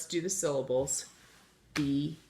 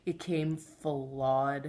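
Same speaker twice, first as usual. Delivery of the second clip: slow demo; 3 dB louder, much slower than usual. Shown as a demonstration. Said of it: "people might say".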